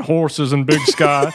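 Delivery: sudden drop out of falsetto into Montana drawl; Montana accent. Acted. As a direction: say it like a Montana rancher. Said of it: "slip back into my Montana accent".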